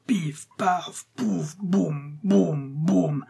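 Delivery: high, separate mouth-made bangs; imitated gunfire. A narrator's reading. Boy playing at war, imitating cannon and gunfire. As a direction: fire each shot as a boy's sound effect. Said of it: "le bruit du canon et de la fusillade".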